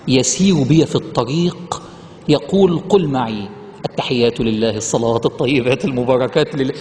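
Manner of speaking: low, slow and solemn recital; reverent hadith recitation. Acted. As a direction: recite the Prophet's words with reverence. Ibn Mas'ud hadith recited. (تلاوة حديث بخشوع وصوت منخفض)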